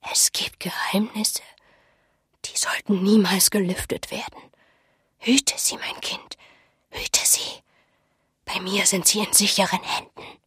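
hushed, breathy, secretive warning; worried old woman confiding in a whisper; mysterious old seer. Acted. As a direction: whisper it urgently, as a secret entrusted.

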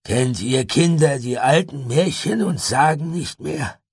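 langsam, gehoben, mit leiser Wehmut; beinahe traurig. A narrator's reading. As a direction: langsam und laut klagend fragen. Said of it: "Ragnar klang beinahe traurig". (fast traurig gefragt)

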